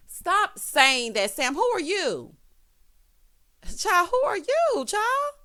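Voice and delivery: loud, high, drawn-out disbelief; exasperated scolding. Real, not acted. Recapper telling Sam off, loud high-pitched exasperation. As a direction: call them out loudly, pitch shooting up.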